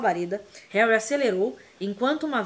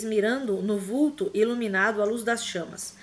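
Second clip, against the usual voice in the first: usual speaking voice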